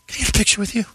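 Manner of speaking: high, small mock fan voice; put-on high pleading question; photo-seeking fan. Acting out photo seeker.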